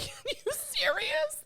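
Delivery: can't believe anyone would ask that, slow and high; incredulous disbelief. Real, not acted. Podcast host telling co-host about critics, in high incredulous disbelief. Outraged at being told to stay silent.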